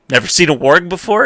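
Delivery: mock Ygritte teasing, fast. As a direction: needle him like Ygritte would. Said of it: "And Ygritte's like".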